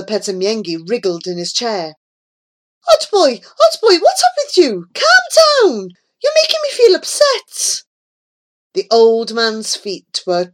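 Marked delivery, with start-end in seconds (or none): 2.9-7.8 loud, very high, agitated cries; upset pleading. Acted. Grandfather to the frantic fiddler, upset high pleading.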